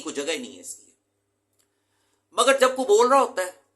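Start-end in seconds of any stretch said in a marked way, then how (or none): 2.4-3.6 emphatic raised voice. Real, not acted. Teacher raises voice for point.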